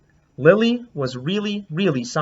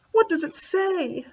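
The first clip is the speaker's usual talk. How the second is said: curious little voice; 13 semitones higher than usual. Acted. Asking about the note.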